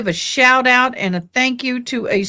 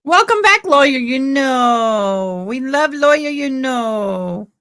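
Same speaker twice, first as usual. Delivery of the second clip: excited sing-song greeting; 3 semitones higher, slower than usual. Real, not acted. Host greets a friendly channel with an excited sing-song cheer. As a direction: hype up the channel you love, slowly.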